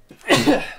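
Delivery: sudden loud cough; coughing fit. Real, not acted. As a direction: cough hard mid-sentence. Sneezing fit after warning.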